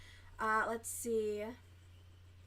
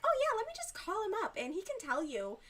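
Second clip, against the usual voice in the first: high, fast mock-agreeable voice; put-on breezy sarcasm, pitched up; sarcastic mock quote; 11 semitones higher, 3 dB louder, faster than usual. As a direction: rattle it off high-pitched, mockingly eager. Sarcastically acts out a hypothetical reply.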